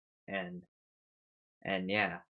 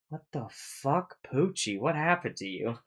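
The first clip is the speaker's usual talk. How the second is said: shocked; 5 semitones higher than usual. Retold or imitated. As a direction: gape at your grown pet in disbelief. Teo shocked at giant Pucci.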